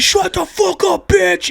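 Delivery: high, slow, strained mock shout; imitated yell from the back. Guy at the back to the yapping kid, imitated yell. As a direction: re-enact a heckler's yell without real volume. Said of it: "this guy just yells it from the back".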